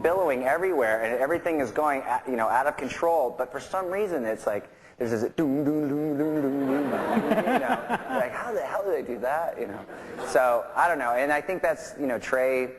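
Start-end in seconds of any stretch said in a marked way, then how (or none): 5.4-7.8 rhythmic vocal drum thuds; mouthing a drum pattern; imitated drum beat. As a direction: sound out a steady beat on each doom. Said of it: "there was always a beat going on".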